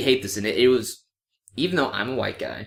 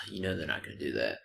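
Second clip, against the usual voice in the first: whisper; 9 semitones lower, 13 dB quieter, faster than usual. Retold or imitated. Repeating the quoted line as a whisper.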